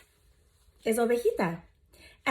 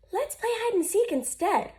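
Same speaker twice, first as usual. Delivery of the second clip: higher, playful Amos voice; playful suggestion; 10 semitones higher than usual. Acted. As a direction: pitch it up, playful. Amos proposes a game.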